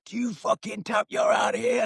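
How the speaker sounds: very high-pitched mock coach yell; imitated angry shouting. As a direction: threaten him like a furious coach, voice shooting up. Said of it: "He's shouting at him".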